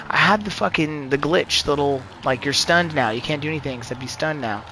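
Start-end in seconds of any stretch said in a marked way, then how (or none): none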